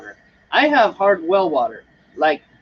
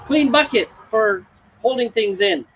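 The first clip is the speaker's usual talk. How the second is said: quoting the friend's reminder; 6 semitones higher than usual. Retold or imitated. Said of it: "And a little while later".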